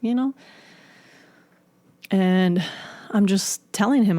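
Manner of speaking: ordinary talk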